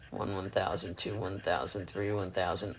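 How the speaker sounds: soft counting. Real, not acted. Counting quietly during puppy weighing.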